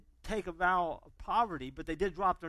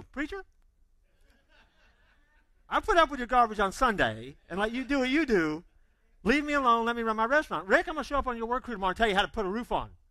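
higher, louder fed-up retort, fast at the end; annoyed owner; 5 semitones higher, 6 dB louder than usual. Retold or imitated. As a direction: push the preacher away, irritated. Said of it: "you're gonna go".

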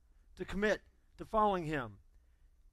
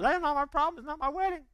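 higher, louder teasing reply; playful brush-off; 9 semitones higher, 4 dB louder than usual. Retold or imitated. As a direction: tease mom, mock-dismissive. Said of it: "Jesus playfully looks back at his mama and goes".

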